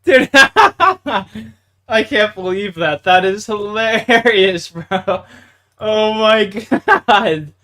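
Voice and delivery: slow, loud, laughing through words; can barely get words out for laughing; real laughter breaking up the speech. Real, not acted. Still laughing, drawn out.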